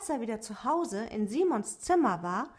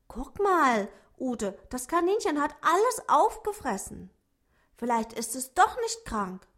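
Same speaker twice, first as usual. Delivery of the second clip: put-on higher, louder boy's voice; relieved boy; 5 semitones higher, 5 dB louder than usual. A narrator's reading. Simon tells Ute the rabbit ate everything, higher voice. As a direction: tell her the good news, relieved.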